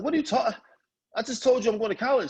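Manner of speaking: high-pitched exasperated comeback; acted-out teenage indignation; exasperated protest. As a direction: protest in disbelief, voice jumping up. Son protests to his mother.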